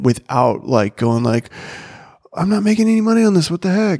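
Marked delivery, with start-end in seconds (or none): 2.3-4.0 exasperated. Retold or imitated. Retold frustrated inner thought.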